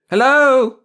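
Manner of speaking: very high, loud hail; shouting full out. A narrator's reading. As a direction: holler as loud as you can. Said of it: "as loud as he could".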